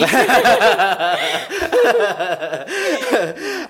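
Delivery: big burst of laughter; hearty laugh. Real, not acted. Host laughs at guest reply.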